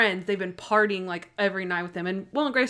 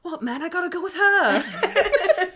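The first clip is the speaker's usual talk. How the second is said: British man; 10 semitones higher, slower than usual. Retold or imitated. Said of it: "he's British or whatever".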